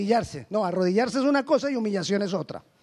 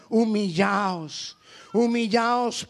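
drawn-out command; much slower than usual. Acted. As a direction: let "Humillaos" sink in, stretched long. Preacher repeats key word slowly. ("Humillaos" muy lento y grave)

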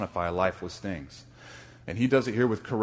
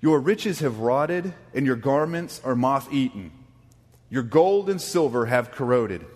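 slow, loud, solemn reading of the text; scripture reading; 4 semitones higher, 4 dB louder than usual. Acted. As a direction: read the verses like a proclamation, slowly. Reads scripture aloud.

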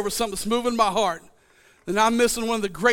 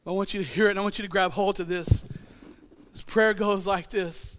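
ordinary talk, nothing marked

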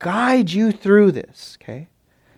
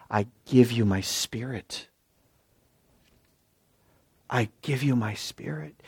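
gentle assurance; 4 semitones lower, 11 dB quieter than usual. Retold or imitated. God's reply, soft and low.

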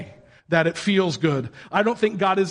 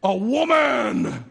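higher, drawn-out mock elation; excited guy; 5 semitones higher, much slower than usual. Preacher acting Adam responding like a guy, excited. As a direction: stretch it out like a guy celebrating. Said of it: "Adam responds like a guy".

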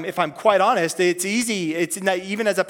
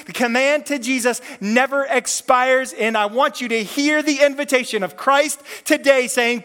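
normal talking voice

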